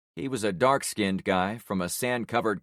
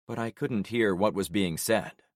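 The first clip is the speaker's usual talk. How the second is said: usual speaking voice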